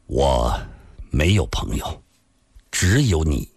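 low voice with pauses between phrases; slow, halting. A narrator's reading. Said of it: "缓缓地说道". (缓缓地低声说)

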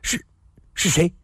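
startled, halting question; a startled blurt; blurting out in shock. A narrator's reading. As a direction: stammer it out, caught off guard. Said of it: "李寻欢失声地说道".

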